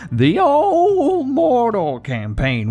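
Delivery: high, slow, squeaky repeat; silly high voice. Pretend. Gamer parodying his own intro in a silly high voice. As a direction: stretch it out in a goofy falsetto.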